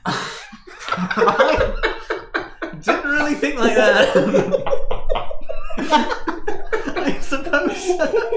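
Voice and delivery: high, giggling embarrassed nerd; laughing nerd. Acted. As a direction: giggle through the admission. Milton caught out, laughing.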